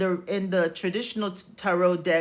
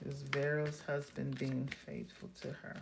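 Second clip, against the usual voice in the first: soft, lower murmur; hushed; 4 semitones lower, 12 dB quieter than usual. Real, not acted. Reader asking Spirit about a husband, hushed. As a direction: ask it quietly, half to yourself. Repeats question to Spirit while shuffling.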